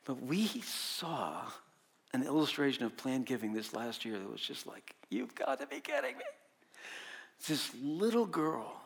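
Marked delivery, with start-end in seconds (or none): 5.1-6.2 amazed disbelief. Retold or imitated. Disbelief at planned giving illustration.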